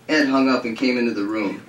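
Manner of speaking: usual speaking voice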